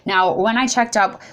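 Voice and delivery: usual speaking voice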